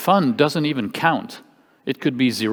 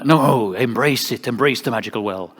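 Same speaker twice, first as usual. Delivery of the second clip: Gandalf-like professor. Retold or imitated. Imitating an art school professor urging students, wizardly. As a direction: insist grandly like an old wizard mentor. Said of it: "he's like Gandalf".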